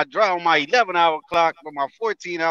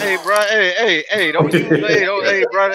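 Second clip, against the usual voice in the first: louder, insistent hey bro; loud calling out; 3 semitones higher, 6 dB louder than usual. Real, not acted. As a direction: cut him off loudly. Cutting in on friend's rant.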